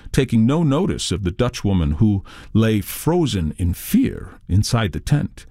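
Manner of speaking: usual speaking voice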